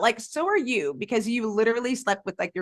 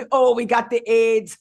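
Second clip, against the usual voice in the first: mock musical line; 2 semitones higher, 6 dB louder than usual.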